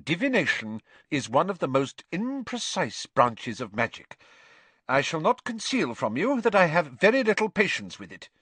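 high, measured teacher voice; controlled disapproval, weighing the key words. A narrator's reading. Teacher telling class her view of divination, controlled disapproval. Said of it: "She went on more calmly".

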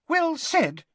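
very high squeak; Professor Flitwick. A narrator's reading. Flitwick applauds the idea, squeaky. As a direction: squeak your approval like a tiny professor. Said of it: "squeaked Professor Flitwick".

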